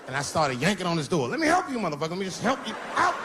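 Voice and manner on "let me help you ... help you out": mock helpful menace. Yanking door, mock helpful menace.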